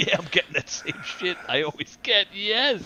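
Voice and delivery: overjoyed dog at dinner, voiced for fun; excited dog voice. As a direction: play the thrilled dog, big "Yes!" on top. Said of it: "They're like".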